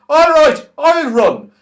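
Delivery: high, loud shout; loud agreement. Acted. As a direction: shout back and get going. Saucepan man agrees loudly.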